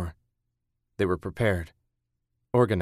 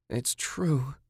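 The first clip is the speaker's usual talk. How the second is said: moaning; 6 semitones higher, 4 dB quieter than usual. A narrator's reading. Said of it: "Julie moaned".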